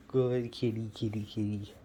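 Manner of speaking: ordinary delivery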